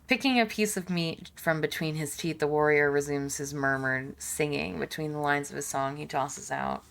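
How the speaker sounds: measured, low-key storyteller read; game narration. Acted. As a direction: read the scene out evenly and softly. Reads game narration aloud.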